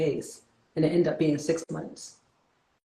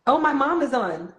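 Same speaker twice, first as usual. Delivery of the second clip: delighted surprise; 10 semitones higher, 5 dB louder, slower than usual. Real, not acted. Spots mom watching live.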